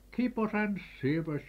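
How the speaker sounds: hammed-up exclamation of dismay; old farmer's cry of exasperation; exasperated shout. A narrator's reading. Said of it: "shouts Bobber".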